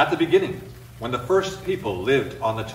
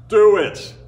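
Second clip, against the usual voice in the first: dismissive dare; 6 semitones higher, 8 dB louder than usual. Acted. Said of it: "clearly not believing him".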